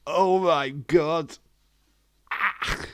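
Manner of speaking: slow, high, loud laughing oh my God; cracking up and dragging it out; stretched out, wheezing with laughter; laughing disbelief. Real, not acted. Reacting to the joke.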